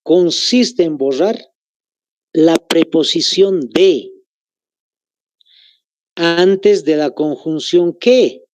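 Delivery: slow, spelled-out dictation pace. Shown as a demonstration. Teacher dictating the rule slowly to students, slow and even. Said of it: "Les vuelvo a repetir".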